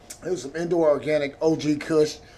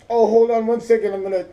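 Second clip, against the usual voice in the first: higher, louder attempt at an Indian accent; imitated Indian accent; 6 semitones higher, 6 dB louder than usual. Mimicking the scam caller asking to hold, imitated Indian accent. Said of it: "I tried to do it. That's racist".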